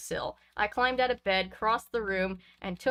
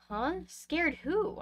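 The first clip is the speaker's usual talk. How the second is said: higher, softer, fake drowsy confusion; sleepy voice; 7 semitones higher, 3 dB quieter, much slower than usual. Boy fakes waking up when parents confront him, sleepy. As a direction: play dumb like just woken up. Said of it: "I asked in a sleepy voice".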